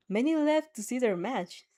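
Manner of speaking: plain reading voice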